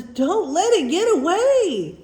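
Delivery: high, loud, urgent plea; upset cry. A narrator's reading. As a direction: call out in distress as something escapes. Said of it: "she's very upset".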